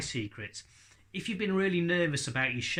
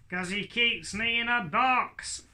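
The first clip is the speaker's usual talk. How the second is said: higher, slow, drawn-out puppet grumble; complaining puppet; 7 semitones higher, 3 dB louder than usual. Acted. Puppet complains to Tilly about the ventriloquist, aggrieved voice. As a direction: drag out the grievance in a raised character voice.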